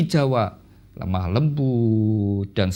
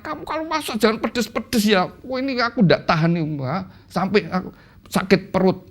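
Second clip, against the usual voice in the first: husband complaining; 6 semitones higher than usual. Retold or imitated. Husband complains about spicy cooking.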